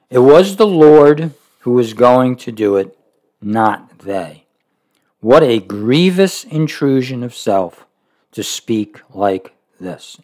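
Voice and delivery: steady measured reading of a devotional book; reading aloud. Acted. Preacher reading Roy's book to listeners, measured reading voice. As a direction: read the passage evenly to the congregation.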